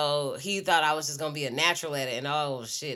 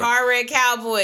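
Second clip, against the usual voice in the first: slow, loud, higher ad slogan; ad announcer; 7 semitones higher, 10 dB louder than usual. Retold or imitated. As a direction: boom it out like a TV lawyer ad.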